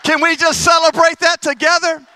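loud, high rallying cry; full-voiced preacher call; rousing call. Real, not acted. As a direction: lift the crowd up with you.

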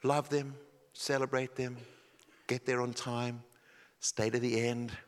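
hushed sincere sermon voice; quiet, earnest preaching. Acted. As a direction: draw them in close and gently. Quiet intimate sermon section.

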